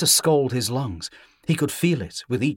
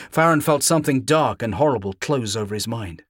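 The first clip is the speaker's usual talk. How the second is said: ordinary delivery, nothing marked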